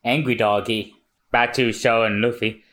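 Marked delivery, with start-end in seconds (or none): none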